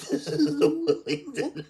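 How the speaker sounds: announced through laughter, high and loud; laughing. Acted. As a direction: laugh as you say it.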